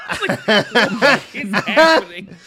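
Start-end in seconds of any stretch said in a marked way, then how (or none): none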